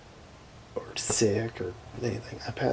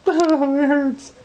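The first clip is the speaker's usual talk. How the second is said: loud, high-pitched fake wail of pain; exaggerated crying, pitched way up; mock crying; 11 dB louder, much slower than usual. Showing what the crying was not like, mock wail. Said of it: "I was literally crying. But it wasn't like".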